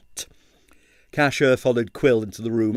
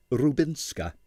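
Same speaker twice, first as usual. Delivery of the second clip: usual speaking voice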